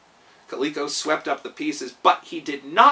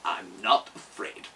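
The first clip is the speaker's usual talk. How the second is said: low, slow machine voice; flat fearless robot, low and slow; Tik-Tok answering the steward, low and unafraid; calm clockwork man; 8 semitones lower, 4 dB quieter, slower than usual. Acted. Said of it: "said Tik-Tok".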